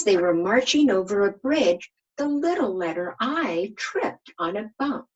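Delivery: lively read-aloud storytelling voice; story reading. A narrator's reading. Teacher reading a picture book to her class, lively storytelling. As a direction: read it out to young children, bright and lively.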